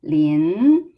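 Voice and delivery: LIN stretched long, pitch climbing; slow upward slide on LIN; rising tone. Shown as a demonstration. Teacher showing second tone, long rising LIN. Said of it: "second tone is rising tone".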